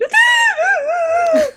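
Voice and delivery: loud, high sobbing wail imitated; mock wail. Demonstrating the horrible crying noises she held in, loud wail. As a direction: let out a hammed-up howl of crying. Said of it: "trying not to be like".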